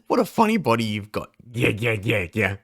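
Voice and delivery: normal talking voice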